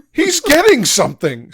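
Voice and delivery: horrified scream, high and loud. Acted. Strom screams as the stream nears, horrified. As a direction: scream in horror. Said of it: "screams Strom".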